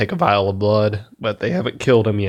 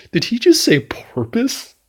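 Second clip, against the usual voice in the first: incredulous quote; 12 semitones higher than usual. Retold or imitated. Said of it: "I was fucking dying after that".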